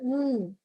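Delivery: a soft, short hummed म, said as a bare sound and not as the letter name. Shown as a demonstration. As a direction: model the म sound for children. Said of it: "म की sound है इसके अंदर".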